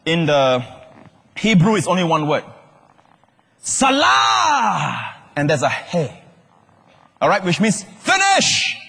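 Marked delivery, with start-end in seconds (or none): 3.6-5.1 long, high, held shout; loud final cry. Acted. Preacher voicing Jesus' cry from the cross, shouting. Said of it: "He cried with a loud voice". 7.8-8.8 high, drawn-out shout; loud final cry. Acted. Preacher translating the cry from the cross, shouting. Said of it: "He cried with a loud voice".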